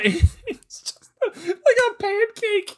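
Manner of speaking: high, slow, squeaking through laughter; crying with laughter as you say it; laughing. Real, not acted. Laughing after the stomp.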